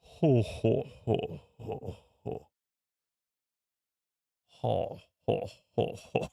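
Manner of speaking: lightly voiced Jabba laugh, partly whispered; a half-hearted Hutt chuckle; imitating Jabba. Streamer mimics Jabba the Hutt speaking Huttese, light mock laugh. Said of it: "Huddies please".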